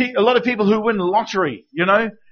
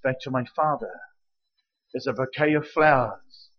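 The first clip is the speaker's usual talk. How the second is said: hushed reverence; 6 semitones lower, 6 dB quieter than usual. Acted. Intimate prayer-like description.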